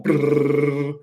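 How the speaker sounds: loud buzzing brrr for twitching eyes; imitated flutter noise. Showing viewers how the eyes went, imitated flutter noise. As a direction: trill it out like a motor.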